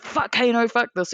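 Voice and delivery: mock angry curse. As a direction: spit it like a sore loser. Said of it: "he's like".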